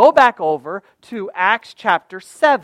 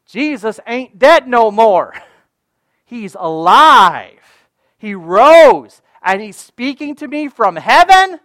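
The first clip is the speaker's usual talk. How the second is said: louder and higher, with a laugh of delight; joyful proclamation; 3 semitones higher, 9 dB louder, slower than usual. Acted. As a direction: shout the good news. Preacher exults over resurrection.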